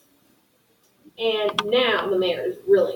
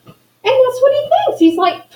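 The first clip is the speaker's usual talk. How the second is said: ordinary delivery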